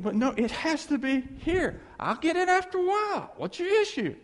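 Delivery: high, put-on voice, defensive. Messy wife brushing off her tidy husband, lightly voiced high. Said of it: "He goes".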